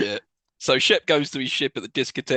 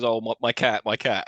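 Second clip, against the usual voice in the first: usual speaking voice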